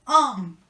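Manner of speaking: "Un" loud and fully nasal. Shown as a demonstration. Teacher showing students how "un" must sound. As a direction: say "Un" loudly through a blocked nose. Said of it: "así se tiene que escuchar".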